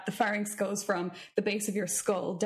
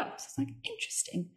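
hushed intrigue; 13 semitones higher, 6 dB quieter than usual. Retold or imitated. Hushed ironic interesting.

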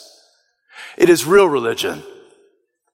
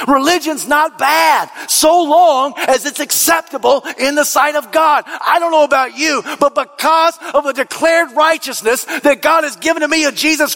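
preaching at full force; 10 semitones higher than usual. Acted. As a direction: build the hall to a fervent cry for real religion.

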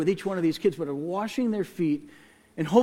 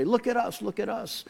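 high, softer sing-out for attention; mock boasting; 5 semitones higher, 4 dB quieter than usual. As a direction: show off in a higher, lighter voice. Mock boast about helping others.

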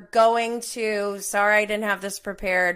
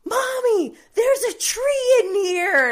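put-on much higher, loud child shout; 14 semitones higher, 6 dB louder, slower than usual. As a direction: shout it like an excited toddler. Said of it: "my youngest goes".

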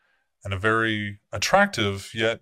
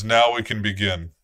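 commanding prince addressing his court; a lightly voiced stern prince; the prince speaking; 3 semitones higher, 6 dB louder than usual. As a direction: speak as the vampire prince laying out the night.

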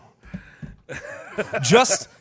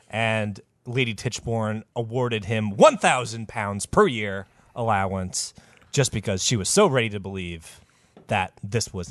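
ordinary talk, nothing marked

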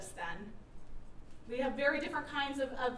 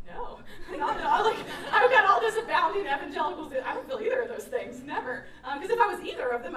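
laughing through the words, higher and louder; laughing denial; 5 semitones higher, 6 dB louder than usual. Real, not acted. Telling her friend Josh she feels neither, laughing. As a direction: laugh off the question.

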